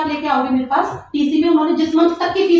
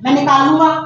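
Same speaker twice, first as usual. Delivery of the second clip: louder mock threat at normal pitch; retold threat; 5 dB louder than usual. Principal quoting a visitor's threat on camera, retold threat. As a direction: throw their threat back at them, voice raised. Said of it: "मुझे धमका के गए हैं".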